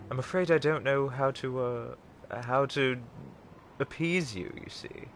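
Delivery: polite put-on British butler; British butler accent. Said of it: "It simply slips out".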